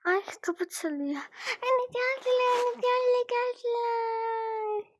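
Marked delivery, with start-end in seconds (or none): 1.6-4.9 high, excited call to mother. Acted. Daughter calling mother about guests, high and excited. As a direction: call out to mom that guests arrived, much higher. Child announcing guests at door.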